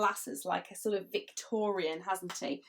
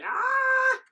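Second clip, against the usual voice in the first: high, loud startled cry; the farmer gasps at his bull; shocked farmer; 7 dB louder than usual. Acted. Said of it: "the farmer that's just looking at his bull going".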